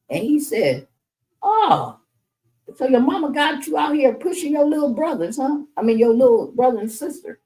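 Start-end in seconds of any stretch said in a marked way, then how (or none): 1.4-7.4 high teasing voice, imitating Fred; higher-pitched playful taunt; mock teasing man. Imitating Fred teasing the young mother about her kids.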